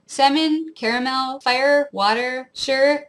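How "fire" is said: ordinary talk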